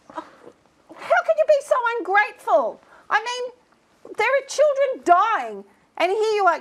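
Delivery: high, loud outraged tirade; indignant scolding. Acted. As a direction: berate the ungrateful intern. Boss berates ungrateful intern.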